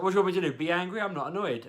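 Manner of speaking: mild mock of the wife arguing over the phone; exasperated wife. Wife snapping back in row.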